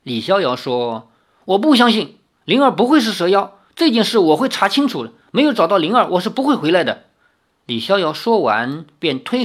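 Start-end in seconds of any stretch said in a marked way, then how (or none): 1.4-7.0 higher, louder, fast vow; defiant. A narrator's reading. Li Xiaoyao vows to find Ling'er, defiant and heated. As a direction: insist hotly he will find her.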